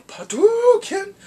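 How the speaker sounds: high, loud, drawn-out battle yell; mock fighting-game special move shout; game attack cry. Imitating a video game attack cry.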